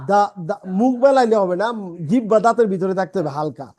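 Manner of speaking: ordinary talk, nothing marked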